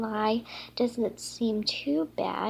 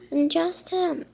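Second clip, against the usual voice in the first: usual speaking voice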